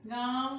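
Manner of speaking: "गांव" with a wide, lightly nasal vowel; open-mouthed nasal vowel. Shown as a demonstration. Teacher asks students to notice, open-mouthed "गांव". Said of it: "मुख को बड़ा करना पड़ रहा है ना".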